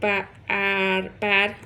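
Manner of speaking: पैर held very slowly; teacher showing a child's reading; slow sound-out. Shown as a demonstration. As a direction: stretch पैर out. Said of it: "तो बताएगा वो".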